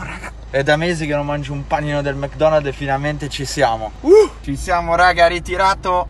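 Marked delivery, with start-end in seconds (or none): 4.0-6.1 excited. Real, not acted. Order picked up, celebrating.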